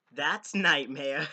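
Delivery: loud, slow, proud reveal; excited announcement. Real, not acted. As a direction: slowly boom out the name. Excited reveal of book cover.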